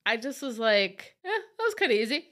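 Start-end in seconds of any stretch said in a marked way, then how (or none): 1.2-2.3 mock unimpressed. Shrugging off the easy win.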